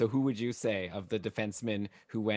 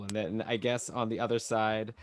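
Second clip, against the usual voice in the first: normal talking voice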